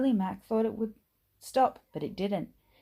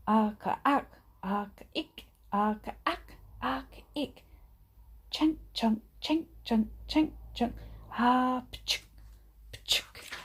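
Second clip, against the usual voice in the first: higher, punchy chugging and clanks; clanking goods train; 5 semitones higher than usual. A narrator's reading. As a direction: clank it out like a heavy freight train.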